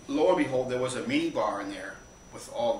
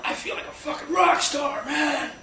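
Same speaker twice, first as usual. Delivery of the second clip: exultant high shout; Mike; 6 dB louder, slower than usual. Retold or imitated. Mike strutting on the tarmac, exultant yell.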